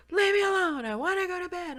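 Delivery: high loud irritated yell; angry shout. Retold or imitated. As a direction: bark back, leave me alone. Husband refusing in a quarrel.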